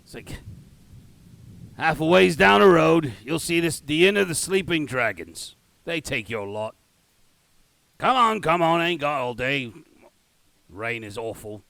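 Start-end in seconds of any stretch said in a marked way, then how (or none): 1.8-11.6 loud Cockney gate guard, easing off; impatient Cockney directions; shitty Cockney accent. Acted. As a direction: hurry them along in bad Cockney. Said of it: "my shitty Cockney accent".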